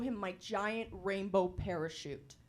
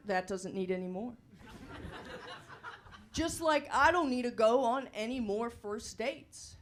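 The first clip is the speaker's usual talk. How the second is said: usual speaking voice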